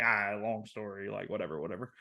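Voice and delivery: low, soft brush-off, fading away; dismissive mumble. Retold or imitated. Suspect brushing off the officer he knows, dismissive mumble. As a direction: wave the question away under your breath.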